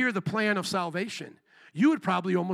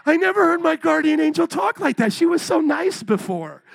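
shocked believer; 9 semitones higher, 8 dB louder than usual. Retold or imitated. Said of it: "surprised believer".

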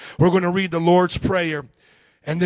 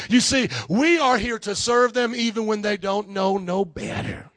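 ordinary delivery

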